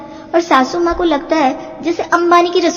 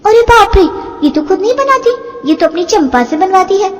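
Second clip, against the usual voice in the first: shocked exclaim; 5 semitones higher than usual. Acted. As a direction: blurt it out in shock. Shocked discovery in kitchen. (चौंककर ऊँची आवाज़ में)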